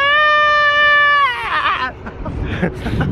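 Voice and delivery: squealing laugh, way up high; high shrieking laugh. Real, not acted. Laughing after Titanic bit.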